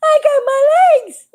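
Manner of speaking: high, loud, slow cry of joy; overjoyed. A narrator's reading. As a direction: crow happily in a high voice. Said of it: "Little Froggy was so happy".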